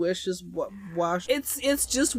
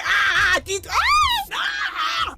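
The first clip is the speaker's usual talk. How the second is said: high yelps; 4 dB louder, much slower than usual. Real, not acted.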